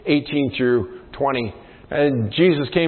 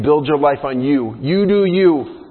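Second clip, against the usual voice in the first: higher, louder, smug self-help voice; mock trendy voice; mocking the culture; 3 semitones higher, 4 dB louder than usual. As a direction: lampoon the you-do-you slogan. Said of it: "our culture is saying".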